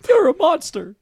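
high-pitched squeaky cricket character; Ethical Bug. Acted. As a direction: squeal it in a tiny cartoon bug voice. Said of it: "fucked up Jiminy Cricket from Puss in Boots".

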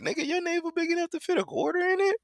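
high-pitched, incredulous question; mock appalled. Appalled friend on the phone about the navel, high mock disbelief. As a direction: squeal it in comic disbelief. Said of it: "And I might be appalled".

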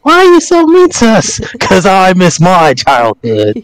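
high-pitched, hammed-up complaint; mock whining outburst. Friends act out envy of park kids, mock high whine. Acted jealous heckling of kids.

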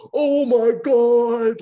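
loud, slow, high cry; loud exclamation. Retold or imitated. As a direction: bellow it like a wild fan. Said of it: "losing his shit all loud".

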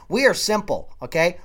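ordinary delivery

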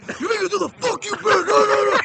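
high, fast imitated chewing-out, rising to a shout; mock drill-instructor tirade; retold yelling. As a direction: berate him as if it's his fault. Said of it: "he's yelling at me".

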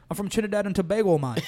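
quick, imitated Trinidadian accent. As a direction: put on a fast Trinidadian lilt. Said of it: "Best Trinidadian accent I've ever fucking heard".

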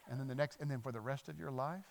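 ordinary delivery

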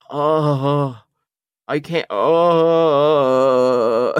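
drawn-out, defeated, strained; overwhelmed groan. Retold or imitated. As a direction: stretch it out, beaten by the taste. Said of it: "I was just like".

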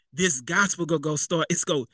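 ordinary talk, nothing marked

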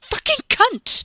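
very high, loud, drawn-out curse; enraged, strained shriek; furious outburst. A narrator's reading. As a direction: spit the insult with full rage. Wes's furious thought.

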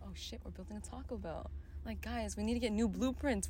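very soft stunned realization; dawning realization. Retold or imitated. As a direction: quietly realize the mistake. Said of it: "they're gonna be like".

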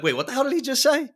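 high, fast, stunned disbelief; retold surprise. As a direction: replay your own double take. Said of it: "and being like".

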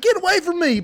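high, loud hammed-up warning; mock tough threat. Boy mock-threatening the girls while spraying mace. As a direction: shriek it at the fence as a joke.

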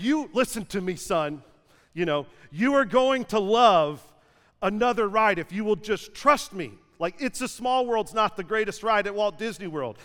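stern dad. Retold or imitated. Dad lecturing son in public.

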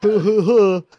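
high, loud mock hooting; sarcastic hoot. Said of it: "as a sarcastic joke".

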